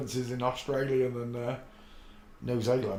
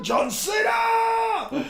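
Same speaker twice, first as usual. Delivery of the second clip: much higher, loud announcer yell; announcer shout; 7 dB louder, much slower than usual. Real, not acted. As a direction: bellow it like a ring announcer. Announcing the quiz winner.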